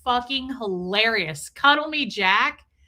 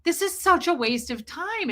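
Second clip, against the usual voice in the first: her own voice pitched up in disbelief; higher, exasperated retort; 6 semitones higher than usual. Retold or imitated. Telling her ex-husband his arguing is pointless, higher and exasperated. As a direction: roll your eyes and say it higher. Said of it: "And I was like".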